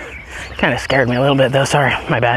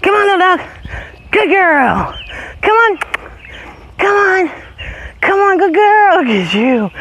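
high dog calls; 14 semitones higher than usual. Real, not acted. Owner calling dogs back up the hill, very high call. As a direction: sing out to the dogs to come.

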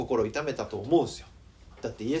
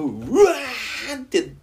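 1 wail; 9 semitones higher, 8 dB louder, much slower than usual. Shown as a demonstration. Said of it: "悲しい時は悲しいんだから".

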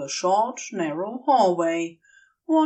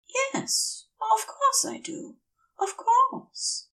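eager to reassure him; Aziraphale, eager reassurance; slower than usual. Acted. Aziraphale eager reassurance, raised pitch.

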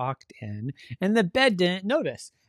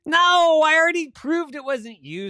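loud, high outburst easing slightly; exasperated protest; 12 semitones higher, 10 dB louder than usual. Acted. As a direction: burst out with a shouted objection. Sister rejects Avery's claim.

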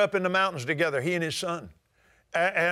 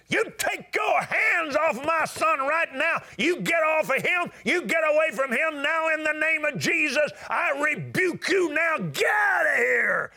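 retold rebuking shout; 10 semitones higher than usual. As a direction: command the devil to leave his son. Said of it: "He screamed at the top of his voice".

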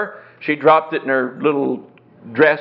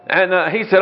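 usual speaking voice

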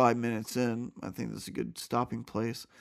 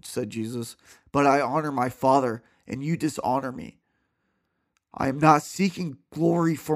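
clear, louder, steady Bible reading voice; scripture reading; 3 semitones higher, 8 dB louder than usual. A narrator's reading. Reading John aloud to listeners, earnest scripture voice. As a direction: read the passage out with conviction.

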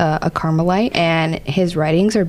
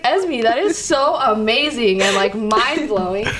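slow, higher, wide-eyed wonder; amazed; 4 semitones higher, slower than usual. Retold or imitated. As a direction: draw out the amazement. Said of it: "I was like".

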